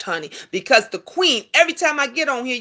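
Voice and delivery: usual speaking voice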